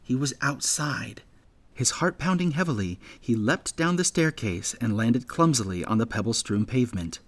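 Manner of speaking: plain reading voice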